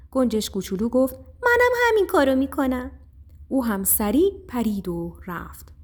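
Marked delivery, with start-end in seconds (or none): 1.2-2.9 high-pitched little bird voice; alarmed little sparrow. A narrator's reading. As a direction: call back high, in a hurry to hide. Sparrow answers mouse as storm breaks. (صدای زیرِ گنجشک کوچولوی هراسان)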